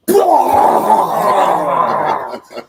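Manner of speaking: loud, high mock vomiting noise; imitated retching. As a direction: act out someone throwing up all over the seat. Passenger suddenly vomits in car.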